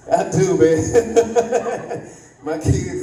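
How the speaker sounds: ordinary delivery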